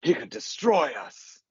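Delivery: high, dramatic doom line; lightly voiced as George Gilmore's odd accent; a hint of a grave warning. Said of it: "he's so dramatic the way he looks up".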